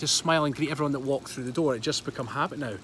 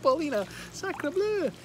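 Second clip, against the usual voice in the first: mock French; 13 semitones higher, slower than usual. Joking French exclamation to viewer.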